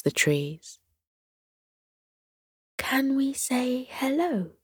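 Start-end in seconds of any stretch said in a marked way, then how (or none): 2.8-4.5 higher, softer hushed question; a child's eager hushed ask; whispered question. A narrator's reading. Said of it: "Sierra whispered back to her mother".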